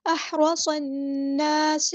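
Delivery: احرصن drawn out with a heavy, full ص; very slow chant of احرصن; tajweed recitation. Shown as a demonstration. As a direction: stretch احرصن very slowly. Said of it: "یہ لمبا کرنا۔".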